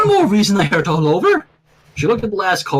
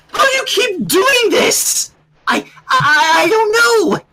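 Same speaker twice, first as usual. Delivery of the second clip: very high, timid squeaky voice; Fluttershy squeak; 14 semitones higher, 3 dB louder, slower than usual. A narrator's reading. Fluttershy answering Rainbow, high timid squeak. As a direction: squeak it out, barely daring. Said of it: "Fluttershy squeaked".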